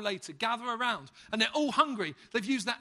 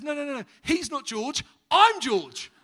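higher and louder, bursting into a laugh; eager dad insisting he is George; indignant dad; 6 semitones higher, 10 dB louder, slower than usual. Acted. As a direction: protest then crack up on the last word. Dad corrects the lady, laughing.

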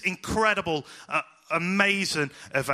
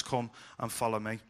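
gentle invitation; 6 semitones lower, 8 dB quieter than usual. Retold or imitated. Jesus's gentle invitation repeated.

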